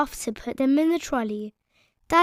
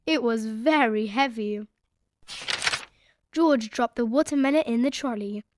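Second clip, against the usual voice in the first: ordinary delivery, nothing marked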